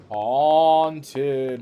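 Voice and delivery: loud, long-drawn ghostly delivery; playful haunted-house wail; mock spooky. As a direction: stretch the word like a hammy ghost. Mock-spooky word for haunted room.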